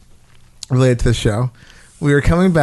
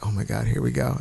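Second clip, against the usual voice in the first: much softer resigned groan of dread; understated dread under the breath; dreading mutter; 4 semitones lower, 11 dB quieter than usual. Retold or imitated. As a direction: brace for the stink, quietly. Retold dread at the smell.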